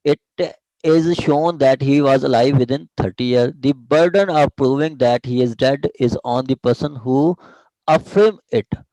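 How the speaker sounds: reading the law out slowly, word by word; slow dictation. Shown as a demonstration. Teacher reading the section slowly to students, dictation pace. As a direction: dictate each phrase slowly for the class to follow. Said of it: "teacher reads section slowly aloud".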